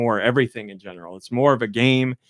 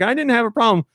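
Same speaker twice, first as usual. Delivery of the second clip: defensive; 11 semitones higher, 4 dB louder, faster than usual. Retold or imitated. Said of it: "I was like".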